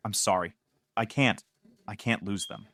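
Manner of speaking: low, soft broken sobbing. A narrator's reading. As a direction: sob out the apology. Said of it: "She sobbed".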